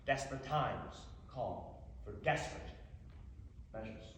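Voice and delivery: low, hushed mock gravity; grave aside. Orphan resolving to get noticed, grave hushed aside. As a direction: drop it down, ominous and soft.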